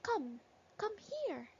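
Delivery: come, come here, lilting high. Shown as a demonstration. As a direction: call a child over: come here. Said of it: "we say it as come".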